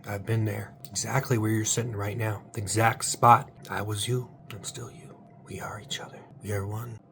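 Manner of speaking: ordinary talk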